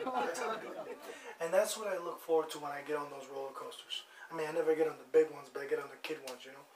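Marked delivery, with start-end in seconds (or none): 0.1-1.0 laughing. Real, not acted. Laughing at own hurl joke.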